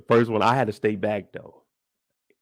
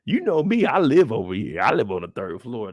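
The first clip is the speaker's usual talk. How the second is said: ordinary talk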